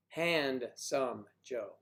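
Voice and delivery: very slow, "Handsome Joe" broken into pieces; HAND, SOME, JOE with gaps between; sounded out slowly. Shown as a demonstration. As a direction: split "Handsome Joe" syllable by syllable. Sounding out a name.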